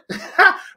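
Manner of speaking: high burst of laughter; laughing. Real, not acted. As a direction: laugh at yourself after singing. Laughing at own singing.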